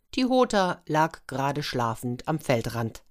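ordinary delivery

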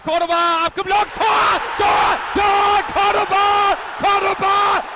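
ecstatic goal call, shouted high; screaming goal commentary. Real, not acted. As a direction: yell the goal like a radio commentator. Radio goal call, Cordoba scores.

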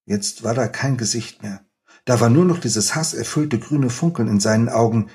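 measured, expressive reading aloud of a scene; story reading. Acted. Author reading his novel to the interviewer, expressive storytelling. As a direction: read the chapter to the audience with feeling.

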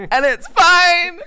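high, slow mock-cheerful; sing-song fake reassurance. High, slow, joking to the host that it's fine.

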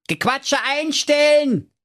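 high, slow bossy command; commanding call. A narrator's reading. Schnitzelschorsch barking at the chattering villagers. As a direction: call them to order, drawn out. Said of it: "rief da der Schnitzelschorsch".

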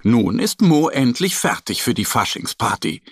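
ordinary delivery, nothing marked

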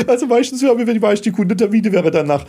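talking through a high laugh; words bubbling out between laughs. Real, not acted. Laughing while answering.

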